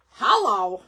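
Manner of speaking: loud, punchy "Hello!" meaning obviously; emphatic hello. Real, not acted. Stressing the great deal.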